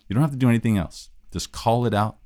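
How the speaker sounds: ordinary delivery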